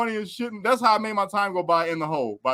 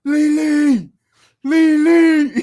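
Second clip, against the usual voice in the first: calling Lily out the window; mock yell across the cells; yelled name; 10 semitones higher, 8 dB louder, much slower than usual. Inmate yelling for Lily through the window, high and loud. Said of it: "I hear this dude yelling".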